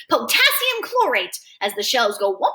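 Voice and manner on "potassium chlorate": high, slow, crowing call; excited shout. A narrator's reading. Freak names chemicals at the fireworks, excited shout. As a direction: crow it out high, stretching the words. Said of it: "Freak keeps on shouting out the names".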